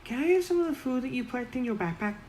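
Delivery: very high, fast goblin pleading; fast high goblin begging. Acted. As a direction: rattle it off high like a hungry goblin. Goblin Momo begs food.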